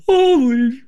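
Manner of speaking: shocked surprise. Retold or imitated. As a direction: blurt out in disbelief. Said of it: "we're all looking at each other".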